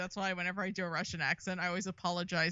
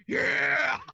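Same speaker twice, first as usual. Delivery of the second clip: higher, loud yelled YEAH; imitated Japanese-dub shout; 5 semitones higher, 7 dB louder than usual. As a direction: belt it out like an over-the-top dub hero. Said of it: "Japanese one, it's".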